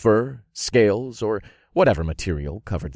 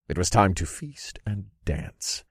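ordinary delivery, nothing marked

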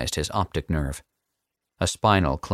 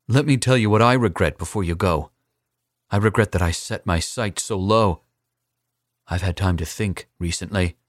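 put-on defiant young assassin; 3 semitones higher, 3 dB louder than usual. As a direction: throw the threat back in his face.

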